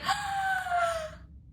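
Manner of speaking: high, long mock gasp; imitated delighted gasp. Husband realising the test result, high excited gasp.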